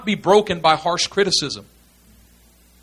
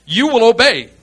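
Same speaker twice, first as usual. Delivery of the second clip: higher, louder barked order; stern command; 6 semitones higher, 5 dB louder than usual. Acted. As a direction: order them firmly to obey. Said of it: "if I just command your will".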